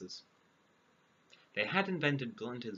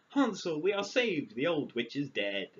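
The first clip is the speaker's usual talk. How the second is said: louder, higher cry of relief; joyful cry; 4 semitones higher, 6 dB louder than usual. A narrator's reading. Gretel calling to her freed brother, joyful cry. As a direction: call out to him with joy. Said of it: "set him free and cried".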